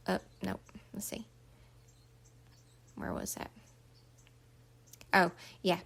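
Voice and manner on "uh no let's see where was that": unvoiced whisper to herself; whispered aside. Real, not acted. Teacher checking her notes, whispering to herself. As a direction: whisper while hunting for the page.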